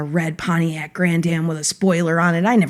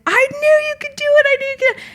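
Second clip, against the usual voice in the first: excited cheer; faster than usual. Retold or imitated. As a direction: cheer for them in a very high voice. Retold cheering her students' success.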